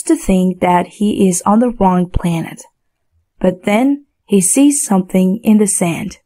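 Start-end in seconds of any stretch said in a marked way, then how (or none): none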